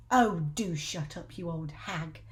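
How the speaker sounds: put-on slow, gruff grumpy old man; Mister Twit voice. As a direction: growl it dismissively. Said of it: "Mister Twit said".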